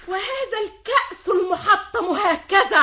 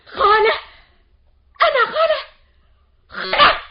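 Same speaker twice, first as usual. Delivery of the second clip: higher, louder indignant repeat; outraged indignation; 10 semitones higher, 7 dB louder than usual. Acted. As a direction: spit it back, offended. Outraged at being called aunt. (الحماة مستنكرة بغضب)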